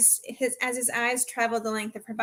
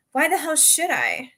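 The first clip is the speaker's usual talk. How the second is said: defiant; 5 semitones higher, 4 dB louder than usual. A narrator's reading. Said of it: "she spouted defiantly".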